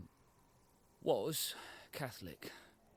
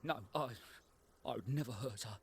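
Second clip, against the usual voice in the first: ordinary delivery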